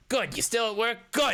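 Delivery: high, abrupt call into the room; agitated boss's shout. Acted. Boss checking on workers in back room, high agitated shout.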